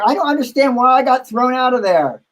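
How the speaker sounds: high-pitched mock innocence; retold indignant protest. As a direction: imitate an aggrieved drag queen, pitched right up. Drag queen protests being thrown out.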